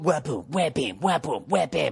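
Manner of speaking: rhythmic scat of a strutting walk; wha-boom, wha-bam sung like a swagger beat; mock strut sound. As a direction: beat out the walk with your mouth. Imitating the singer's strut.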